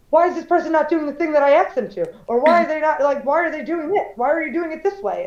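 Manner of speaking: mock the mom's irritated complaint; mad mother. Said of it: "get mad about it".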